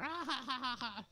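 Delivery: high-pitched imitated ha-ha laugh; mock laughter. Parents sitting there laughing, high mock laughter. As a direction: fake a string of high laughs.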